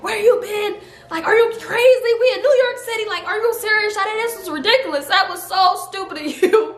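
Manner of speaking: high-pitched, indignant mock scolding; retold yelling. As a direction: imitate an angry friend telling you off, without full volume. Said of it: "came to the door yelling like".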